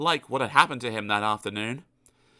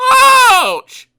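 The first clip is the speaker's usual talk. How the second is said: high, loud cry of pain; yelp of pain; 18 dB louder than usual. Acted. Mister Happy crying out when his foot is trodden on, high and loud. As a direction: yelp as your foot is stepped on. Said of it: "said Mister Happy".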